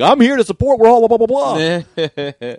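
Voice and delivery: high, loud mock enthusiasm tailing off; hammed-up cheery troupe leader; granddaddy of improv. Imitating the guy running the jam, gushing support. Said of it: "who's, like, the granddaddy of them all".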